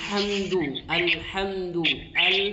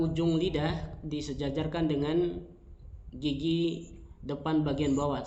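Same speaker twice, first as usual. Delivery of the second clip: ordinary delivery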